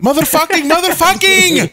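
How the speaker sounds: high, drawn-out swearing yell; frustrated yelling. Real, not acted. Yelling after being turned down.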